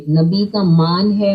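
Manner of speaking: مان with a long open aa; correct long vowel. Shown as a demonstration. Teacher showing children the right مان, long aa. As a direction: stretch the aa in مان fully. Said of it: "اسے کھینچ کے اونچا کرنا ہے".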